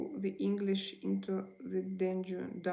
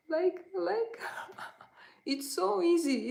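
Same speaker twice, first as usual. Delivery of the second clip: high-pitched, laughing through the words; amused laughing; 10 semitones higher than usual. Real, not acted. Streamer amused at how easy it was, laughing. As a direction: say it high with a real laugh breaking in.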